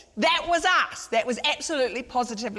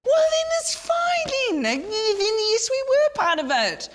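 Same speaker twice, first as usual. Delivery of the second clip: cracking high voice; 4 dB louder, slower than usual. Acted. Minister cornered, voice cracking high.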